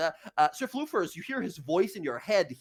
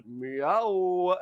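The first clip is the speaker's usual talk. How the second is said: far-off cat meow; distant mock meow; 3 dB louder than usual. Cat calls from the hedges, mock meow. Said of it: "you hear from further down in the bushes".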